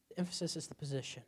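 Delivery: ordinary talk, nothing marked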